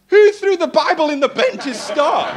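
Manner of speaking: laughing question. Real, not acted. Laughing at the bin Bible.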